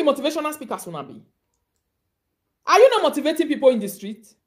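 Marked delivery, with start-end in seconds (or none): 2.7-4.3 scolding. Real, not acted. Scolding rant.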